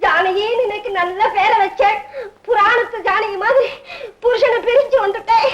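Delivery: hammed-up crying complaint; tearful lament. Daughter laments leaving her husband, tearful lament. Wailing about separation from husband.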